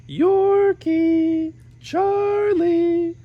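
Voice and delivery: high, loud distant calls; calling out. Acted. Parents calling their sons from outside, loud high call. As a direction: call the boys from far off.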